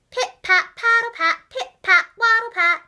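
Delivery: march the words like waddling ducks; duck footsteps chant. A narrator's reading. Narrator imitating the ducks' marching feet, loud and bouncy.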